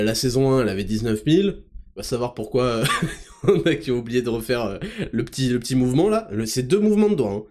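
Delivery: usual speaking voice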